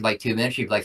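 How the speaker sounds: ordinary delivery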